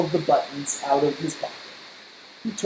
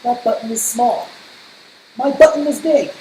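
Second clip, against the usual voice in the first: indignant; 5 semitones higher, 5 dB louder than usual. Acted. Toad rejecting small button.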